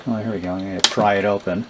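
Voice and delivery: normal talking voice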